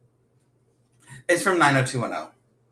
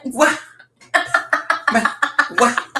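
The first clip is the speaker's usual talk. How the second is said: high sharp disbelieving what; surprised what; 11 semitones higher, slower than usual. Real, not acted. Stunned friend reacting to pebbling news, a high surprised what.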